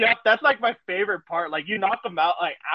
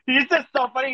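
usual speaking voice

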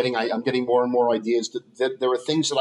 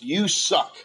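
retold yell from the crowd, pitch climbing higher; mock heckler yell; 6 semitones higher, much slower than usual. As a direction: toss the insult up like a heckler in the back row. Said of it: "And he yells".

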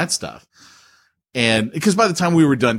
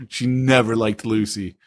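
ordinary talk, nothing marked

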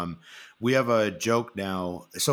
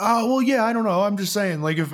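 much higher, louder hammed-up sheepish dodge; mock flustered friend; 13 semitones higher, 8 dB louder than usual. Friend caught wanting back on the show, hammed-up flustered squeak.

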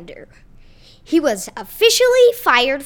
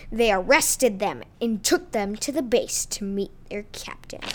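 ordinary delivery

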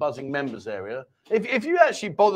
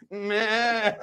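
imitated sheep's "meh"; mock sheep bleat; 4 semitones higher than usual. Bleats after asking about sheep picture.

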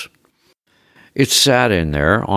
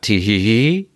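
higher, programmed tee-hee giggle; bimbo giggle; 4 semitones higher than usual. A narrator's reading. Robot dodges the wife's question, bimbo giggle. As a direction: spell out a fake, rising bimbo laugh. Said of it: "The bimbo laugh programmed into it".

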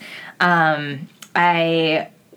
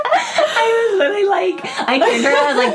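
high, loud laughing speech; laughing hard; 6 dB louder than usual. Real, not acted. Dancer still cracking up while telling her friend, laughing hard. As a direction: talk through tears of laughter.

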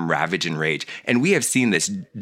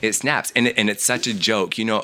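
normal talking voice

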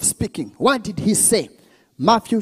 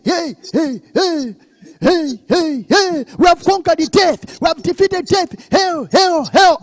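high, raised victory cries; triumphant hailing; 6 semitones higher, 4 dB louder than usual. Acted. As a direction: hail the victor like a marching crowd. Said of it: "They were hailing".

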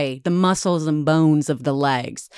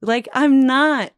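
retold crying denial, pitched up; tearful denial; 8 semitones higher than usual. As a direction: hold back tears while denying. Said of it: "And she's like".

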